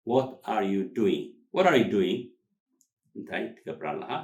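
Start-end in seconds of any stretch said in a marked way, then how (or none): 0.1-1.2 slow, each word set apart; slow word-by-word model. Shown as a demonstration. Teacher says it word by word. 1.5-2.2 louder, words run together; natural linked delivery. Shown as a demonstration. Teacher says it linked.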